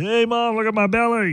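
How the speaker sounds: put-on high, childish kid voice; five-year-old voice. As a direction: play a little kid showing off. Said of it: "like I'm a five-year-old".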